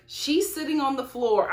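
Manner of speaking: ordinary talk, nothing marked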